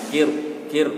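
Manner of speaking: "kir" with a heavy, rounded R; thick final R. Shown as a demonstration. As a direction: say "kir" through pouted lips, R thick. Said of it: "Itu yang tebal".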